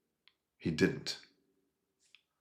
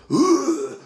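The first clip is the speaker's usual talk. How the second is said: mock scream; 10 dB louder than usual. Mocking a screaming, crying hero.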